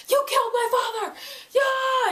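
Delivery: high, mock enraged yell; hammed-up cry of fury, high and fast; super mad. Jace lashing out at his father's killer, super mad. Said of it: "he gets super mad".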